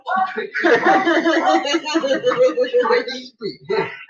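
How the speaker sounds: high rolling laugh; real extended laugh; long laugh. Real, not acted. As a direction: keep laughing at length.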